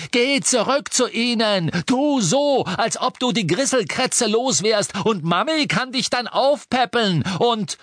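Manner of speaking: high, loud, furious rant; thundering tirade. A narrator's reading. Harry sending Ron back to his family, thundering rant. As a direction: keep bellowing, mocking him in rage. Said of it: "donnerte Harry".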